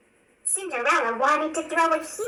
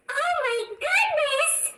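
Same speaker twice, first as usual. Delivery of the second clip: high delighted exclamation; excited cry; 11 semitones higher than usual. A narrator's reading. Rapunzel bursting out of the bushes, delighted cry. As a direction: burst out with wonder. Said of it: "Rapunzel cried as she burst out".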